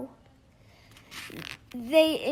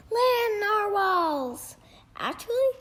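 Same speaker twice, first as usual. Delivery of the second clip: excited unicorn; 7 semitones higher, 3 dB louder than usual. Acted. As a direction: greet him with big delight, then a high correction. Unicorns greet Kelp.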